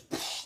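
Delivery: breathy burst of "psh"; imitated smashing sound. Showing Star Platinum smashing the glass, whooshing sound effect. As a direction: mimic glass shattering with your mouth. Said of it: "he goes".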